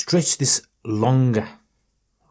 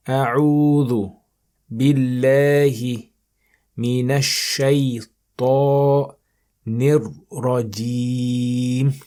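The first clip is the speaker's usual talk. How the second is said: Quran recitation; 4 dB louder than usual. Shown as a demonstration. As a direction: recite word by word for students to copy. Teacher recites verse slowly.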